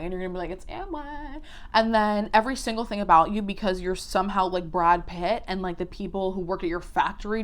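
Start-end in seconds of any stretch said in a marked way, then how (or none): 0.5-1.4 high, soft, put-on little voice; coy mock answer. Voices a branded person's coy answer.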